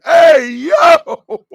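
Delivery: high, loud hey yo; excited shout. Real, not acted. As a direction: call out in delight. Excited outburst.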